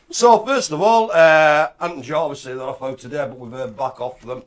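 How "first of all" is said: ordinary talk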